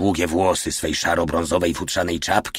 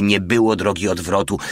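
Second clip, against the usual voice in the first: usual speaking voice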